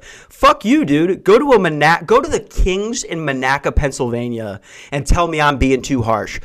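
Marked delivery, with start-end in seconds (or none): 0.0-3.0 higher and louder mock snap at the listener; mock outburst. To a listener calling him harsh, a playful higher, louder snap. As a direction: bark it back playfully.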